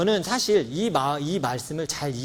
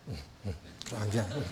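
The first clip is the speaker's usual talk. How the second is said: casual indifference; 5 semitones lower, 9 dB quieter, faster than usual. Retold or imitated. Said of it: "되게 태연한 척했어요".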